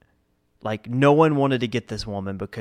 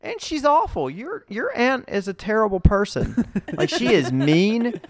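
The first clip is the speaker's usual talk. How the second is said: heated retort; 11 semitones higher, 4 dB louder than usual. Retold or imitated. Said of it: "I'm like".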